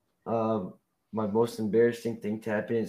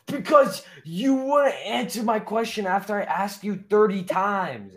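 higher, exasperated complaint with stretched ending; indignant; 6 semitones higher, 4 dB louder than usual. Real, not acted. Arguing with the co-host over an unanswered question, indignant. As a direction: argue back, drawing out the last words.

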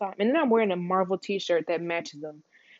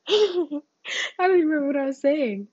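high-pitched laughing confession; 8 semitones higher, 4 dB louder than usual. Real, not acted. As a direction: laugh through the words, pitch climbing. Laughs at losing her thread.

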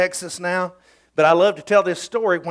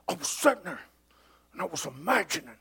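a lightly voiced soft-spoken friend; Todd's voice; 3 semitones lower, 10 dB quieter, faster than usual. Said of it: "he talked like this".

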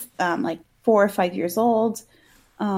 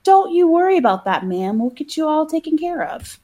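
high-pitched folksy reassurance; store guy; 6 semitones higher than usual. Acted. Store guy comforting the crying mom, high acted reassurance. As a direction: reassure as a helpful store worker. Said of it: "the store guy is like".

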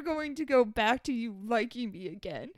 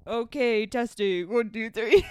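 louder mocking mic check, ending in a shout; mock sound check; 3 semitones lower, 7 dB louder than usual. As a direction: parody him testing the mic, hammed-up. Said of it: "it literally gives me the ick when you're on here".

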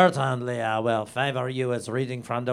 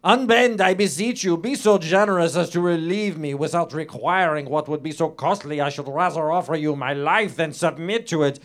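higher, pleading heroine in a German-sounding reading voice; hammed-up pleading; 6 semitones higher, 4 dB louder than usual. Justine begs Dubourg on her knees, hammed-up pleading. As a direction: beg melodramatically for mercy. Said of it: "weeping, clutching the wicked man's knees".

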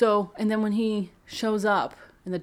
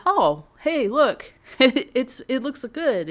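pleasantly surprised; 6 semitones higher, 5 dB louder, slower than usual. Pretend. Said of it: "and you'll say".

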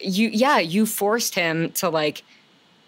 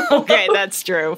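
laughing through the words; real laughter while agreeing; 4 dB louder than usual. Real, not acted. Laughing at the joke.

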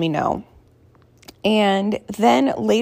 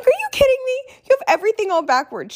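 much higher, disbelieving and fast; incredulous; 13 semitones higher, faster than usual. Retold or imitated. Mom scolding daughter's mixed-up swear rules, incredulous and higher. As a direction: act floored by how backwards she has it.

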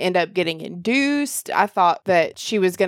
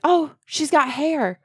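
excited; 6 semitones higher than usual. Retold or imitated. Voicing excitement while pushing.

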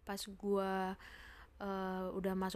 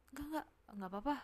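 quick, higher, nervous-sounding denial; hurried brush-off; 6 semitones higher, faster than usual. Retold or imitated. Friend quickly insisting nothing is wrong, hurried. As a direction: rush the denial to end the talk.

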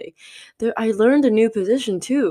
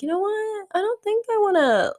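a sudden much higher squeak of realization; high-pitched inner voice. Retold or imitated. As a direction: jump way up in pitch as if thinking aloud. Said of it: "I was like".

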